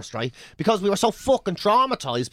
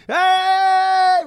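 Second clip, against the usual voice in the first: high, loud, long held shout; imitated kids' cheer; 11 semitones higher, 7 dB louder than usual. Island kids running out to greet arrivals, imitated cheer. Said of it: "kids are coming out going".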